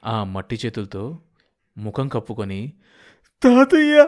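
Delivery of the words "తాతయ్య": very high, loud cry of grief; loud scream. Acted. Grandson with earth-covered hands over his face, grief-stricken scream. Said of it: "అని బిగ్గరగా అరిచాడు".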